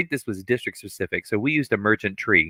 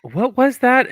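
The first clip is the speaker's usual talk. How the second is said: sharp, high "what was that?"; pitched-up startled demand; startled teacher; 6 dB louder, slower than usual. Retold or imitated. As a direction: snap back in mock surprise. Teacher retorting to overheard student.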